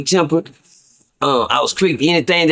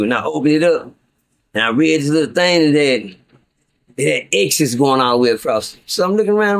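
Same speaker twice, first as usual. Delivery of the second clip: ordinary talk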